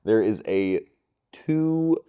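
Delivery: ordinary delivery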